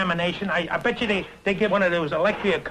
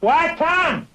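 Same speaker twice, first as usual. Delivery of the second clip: high-pitched raised call; 9 semitones higher, 4 dB louder than usual. Acted. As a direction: call across the room. Calling out across the room.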